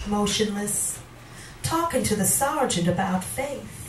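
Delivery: poetry reading. Acted. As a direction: swell on the warnings, then fall away. Reads a poem aloud.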